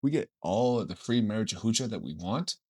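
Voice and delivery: very soft, low acted voice; hushed, deep mock scheming; conspiratorial pitch. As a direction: quietly let the gang in on a deal. Voicing lion conspiring with others.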